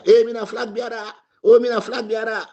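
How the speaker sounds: high, hammed-up bragging voice; mock boast. Mocking a rival bragging to listeners, in a high hammed-up boast. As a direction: crow it like a self-made flag bearer. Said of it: "Pastor make propaganda".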